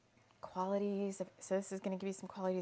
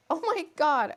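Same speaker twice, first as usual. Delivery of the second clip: much louder, higher burst of disbelief; shocked exclamation; 7 semitones higher, 12 dB louder than usual. Real, not acted. Hearing Merlin name gnome's tears, loud shocked outburst. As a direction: blurt it out, floored.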